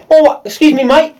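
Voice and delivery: imitated Cockney postman. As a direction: try for attention in a Cockney accent. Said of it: "He's a Cockney".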